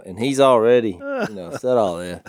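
normal talking voice

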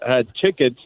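usual speaking voice

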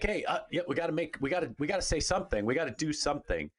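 imitating someone pressured to speak up; mock panic. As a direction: act out a little panic, stumbling over the start. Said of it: "they just panicked a little bit".

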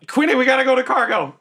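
high, urgent character call. Acted. Character urgently calling to Quinny.

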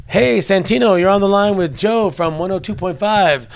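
high, loud on-air hookup announcement; radio liaison. Retold or imitated. Liaison putting wrestler on the line with radio station, loud announcing. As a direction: imitate a liaison calling out an introduction.

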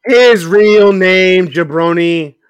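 loud, high, drawn-out correction; exasperated retort. Real, not acted. Co-host correcting the other about a wrestler's name, exasperated. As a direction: stretch it out loudly at a slow-witted friend.

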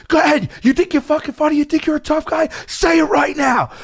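high-pitched taunting tirade; imitated angry yell. Furious wrestler daring his heckler to repeat it, angry yelling. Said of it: "while he's yelling at me".

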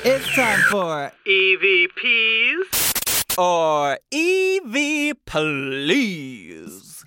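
slow drawn-out spooky ghost announcement; glitchy giggling ghost whisper. Acted. Spooky segment intro with laughter.

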